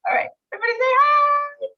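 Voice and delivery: rally everyone to wave for the picture; excited call out. Real, not acted. Host calling the team to say hi for a photo, excited and high.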